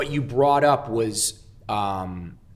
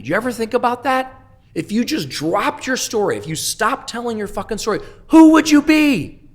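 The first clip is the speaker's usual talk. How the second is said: screaming; 11 semitones higher, 8 dB louder than usual. Retold or imitated. Sponsor confronting him about his story, screaming very high. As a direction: build to a shrieking climax on who would you be. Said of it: "red in the face screaming at me".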